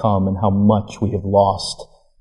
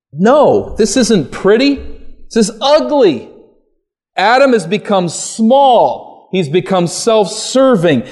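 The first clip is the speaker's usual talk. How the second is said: higher and louder, driving the point home; preaching at full force; 7 semitones higher, 3 dB louder than usual. Acted. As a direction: thunder the rebuke of Adam from the pulpit.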